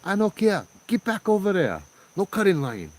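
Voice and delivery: hammed-up angry local telling them off; stern scolding. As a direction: scold the cutters, no nonsense. Local man scolding tourists.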